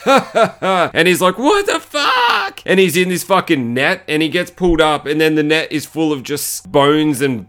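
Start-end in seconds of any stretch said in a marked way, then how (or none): 0.0-0.9 higher imitated "ha, ha, ha"; short separate bursts, imitating the character; mock panting laugh. 1.4-2.6 very high, drawn-out mock yell; mock panicked yell. Retold man thrown into pit.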